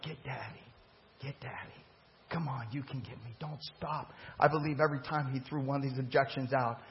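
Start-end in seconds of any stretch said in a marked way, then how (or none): 0.0-4.1 much softer, gentle lowered coaxing. Retold or imitated. Jesus quietly urging the woman on, soft and gentle. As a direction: lure her in tenderly, almost under the breath. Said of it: "He's like".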